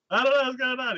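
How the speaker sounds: high, loud flustered outburst; panicked. Retold or imitated. Hero hearing a foreign language, flustered and loud.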